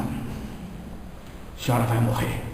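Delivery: hushed and slow, almost no voice; slow near whisper. Retold or imitated. Medium whispers the death news to the lady, hushed and slow.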